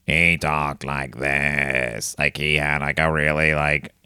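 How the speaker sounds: very slow, low monotone; droning, drawn-out creepy narrator; William S Burroughs. Shown as a demonstration. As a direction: drag every word out on one deep note. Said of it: "That's my William S Burroughs impression".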